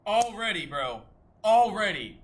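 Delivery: loud high disbelieving yell; panicking gamer. Real, not acted. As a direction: shout it at the screen.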